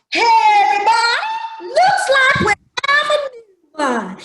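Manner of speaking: high, loud calling out; slave character voice. Acted. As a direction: call out high and loud to everybody. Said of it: "I don't talk like that".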